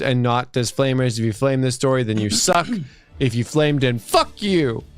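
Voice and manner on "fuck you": ordinary talk, nothing marked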